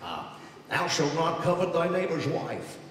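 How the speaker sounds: put-on deadpan like an old vaudevillian; George Burns as God. God handing Moses commandments, old comic rasp. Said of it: "George Burns".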